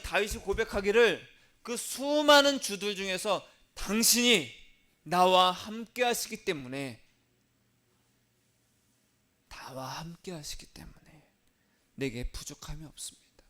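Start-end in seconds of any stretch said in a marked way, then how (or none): 1.6-7.0 slow, weighty proclamation; emphatic confession. Acted. Preacher voicing David's confession, emphatic slow proclamation. 9.5-13.4 hushed confession. Acted. Preacher repeating David's confession to himself, hushed whisper.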